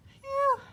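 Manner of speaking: high loud relieved sigh; relieved phew. A narrator's reading. Hugly seeing his reflection, high relieved phew. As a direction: blow out in relief. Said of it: "he said".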